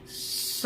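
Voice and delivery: स as a long voiceless hiss; a breathy sibilant स demo. Shown as a demonstration. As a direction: let स hiss out softly between the teeth. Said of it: "शुरू में एक सिसकारी निकल गई".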